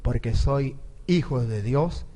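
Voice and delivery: low, deliberate retort meant to sting; imitated comeback, low and assured; pointed proud retort. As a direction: answer back low, each phrase set apart to rub it in. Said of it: "pa' que les duela".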